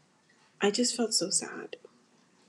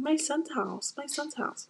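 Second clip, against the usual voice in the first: higher-pitched mimicry of the in-laws, lightly done; mocking mother-in-law; 7 semitones higher, 3 dB quieter than usual. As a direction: parrot their possessive claim, a bit higher. Mocking in-laws claiming the house.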